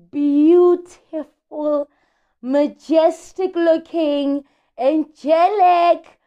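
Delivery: slow, savoured Beautiful, stretching the word out; slowly relished Beautiful, each word stretched; drawn-out admiring praise. Real, not acted. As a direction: linger on Beautiful as if in awe.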